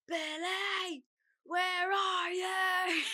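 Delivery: high, long-held yell of a name; loud drawn-out call. Retold or imitated. Ex yelling for her outside the flat, loud drawn-out call. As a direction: imitate an ex hollering up from outside, stretched out. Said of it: "And I heard very loudly".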